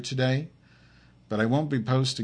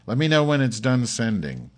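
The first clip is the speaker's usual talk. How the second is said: ordinary talk, nothing marked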